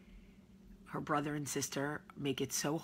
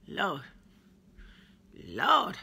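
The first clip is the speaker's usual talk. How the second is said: exasperated; 3 semitones higher, 6 dB louder than usual. Real, not acted. Hair knots.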